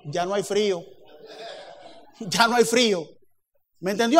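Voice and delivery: normal talking voice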